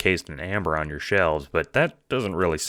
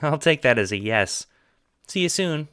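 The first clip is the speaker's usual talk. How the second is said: put-on much higher, eager young John; 10 semitones higher, 3 dB louder than usual. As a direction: pitch way up as the cheerful friend. John signing off.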